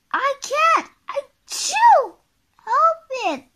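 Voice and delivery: very high, loud sneezing reply; Mister Sneeze. Acted. Sneezing character answers Miss Bossy, high and loud. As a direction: sneeze loudly mid-sentence in a squeaky-high voice. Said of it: "replied Mister Sneeze".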